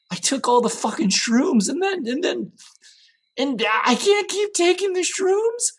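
spiralling up into a high-pitched freak-out; panicked rant. Acted. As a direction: lose your grip, voice climbing very high. Singer panics alone over the drugs.

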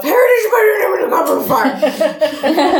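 high, garbled frantic yell; mock-furious movie meme shout; Dumbledore yelling. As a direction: blurt it out high and loud in one rush. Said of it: "abusing Harry and shoving him into a bookshelf and yelling".

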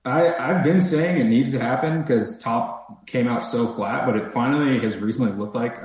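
ordinary delivery

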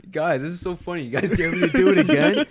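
giggly imitation. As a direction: imitate him egging them on, laughing through it. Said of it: "the first time he's like".

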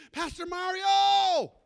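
long, high, loud call across the street; screaming a name. Retold or imitated. Man calling the pastor from across the street, loud drawn-out yell. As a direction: yell the name from far off, stretched out slow. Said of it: "I hear this guy screaming my name".

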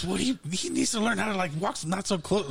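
high, defensive imitation; Blair. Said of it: "And she goes".

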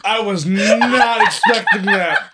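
laughing through the words; can't stop laughing. Real, not acted. Host cracking up laughing.

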